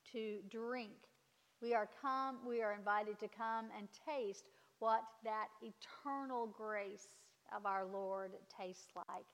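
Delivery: ordinary talk, nothing marked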